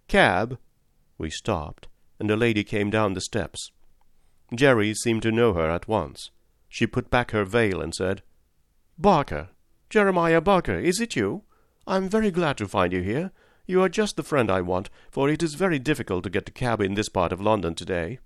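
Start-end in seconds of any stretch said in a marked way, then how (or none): 0.1-0.6 raised, higher-pitched hail; calling out. A narrator's reading. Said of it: "the porter called out". 9.0-18.2 higher-voiced, pleasantly surprised lady; friendly lady. Lady greets known cabman.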